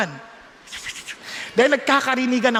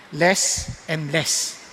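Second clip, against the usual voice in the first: very slow, lower, softer "Less and less"; slow emphasis; 9 semitones lower, 3 dB quieter, much slower than usual. Real, not acted. Preacher to congregation, a very slow, soft emphasis. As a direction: let each word sink in. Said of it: "preacher drives home sin less".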